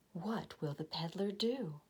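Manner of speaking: suspenseful whisper. A narrator's reading. Reader to children, whispered cliffhanger question. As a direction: hush it to keep them guessing.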